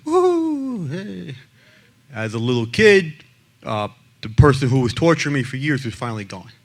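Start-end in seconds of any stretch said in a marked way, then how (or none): 0.0-1.3 mock cheer. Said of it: "And I said".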